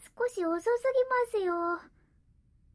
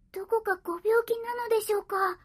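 worried; 8 semitones higher, faster than usual. Acted. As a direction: call out to him in alarm. Cat worries master is sick.

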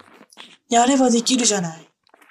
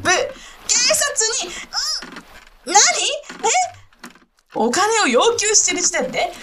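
much higher, frantic flustered pleading; overpowered mistress, high and frantic; panicked protests; 10 semitones higher than usual. Acted.

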